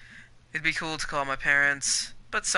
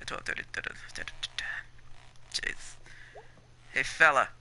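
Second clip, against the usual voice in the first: ordinary talk, nothing marked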